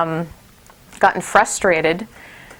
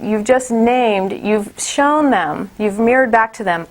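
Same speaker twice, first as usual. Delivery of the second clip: usual speaking voice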